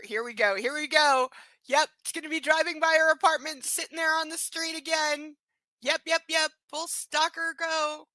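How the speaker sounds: sarcastic here we go to the chat; mocking anticipation. Real, not acted. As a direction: groan it like you saw it coming. Mocking predicted stalker scene.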